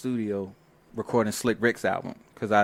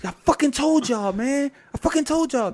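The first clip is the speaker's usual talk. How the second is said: higher, louder mock-angry retort; imitating angry fifty; 13 semitones higher, 5 dB louder than usual. Fifty snapping at the producers, mock angry and loud. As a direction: replay fifty's angry told-you-so. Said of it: "fifty got mad as shit".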